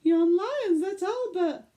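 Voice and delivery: high, loud holler of alarm; shrill-pitched shout from the zoo crowd. Acted. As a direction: yell it like a mother who's just seen her son vanish. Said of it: "She hollered".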